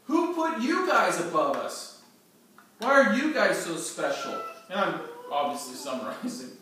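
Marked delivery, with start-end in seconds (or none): 0.0-4.4 louder, higher resentful demand; resentful challenge. Acted. Korah's rebels challenging Moses and Aaron, louder and resentful. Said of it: "And then they said".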